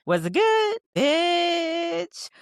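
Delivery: high, loud gossipy squeal; squealing girlfriend. Acted. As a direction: squeal it like a nosy best friend.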